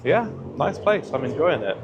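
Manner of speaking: ordinary delivery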